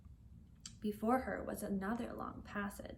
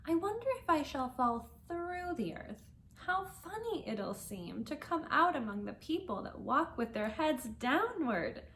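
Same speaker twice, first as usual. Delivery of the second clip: put-on a little girl's voice, higher and wondering; young Alice, amused at her own idea; Alice's voice; 5 semitones higher than usual.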